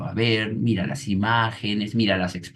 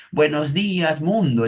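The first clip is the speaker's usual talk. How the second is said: niño alegre; 8 semitones higher, 5 dB louder than usual. Retold or imitated. El niño saluda al llegar.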